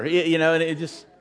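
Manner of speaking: ordinary talk, nothing marked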